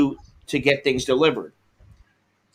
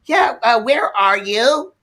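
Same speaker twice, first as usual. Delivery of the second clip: high, slow, puzzled driver; mock driver voice; 7 semitones higher, slower than usual. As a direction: drag out the lost driver's question. Delivery lady quoted, lost.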